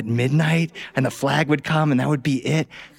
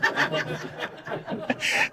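laugh; 8 dB quieter than usual. Real, not acted. Laughing at the punchline.

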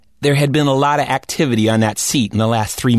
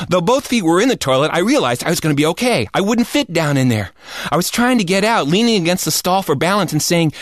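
usual speaking voice

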